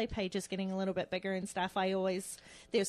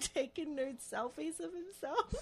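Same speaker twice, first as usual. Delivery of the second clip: higher, louder, laughing hard; 5 semitones higher, 17 dB louder, slower than usual. Real, not acted. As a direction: crack up while telling it. Laughing at funny reveal.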